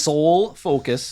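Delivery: slow Minnesota vowels on Soul Focus; drawn-out Minnesota "Soul Focus"; Minnesota accent. Shown as a demonstration. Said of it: "Minnesota accent".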